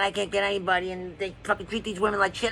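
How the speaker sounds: ordinary talk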